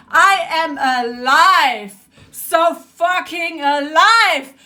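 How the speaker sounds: slow, weighted stress on "alive"; emphatic declaration. Real, not acted. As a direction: stretch out "alive" with defiant force. Emphatic insistence after outburst.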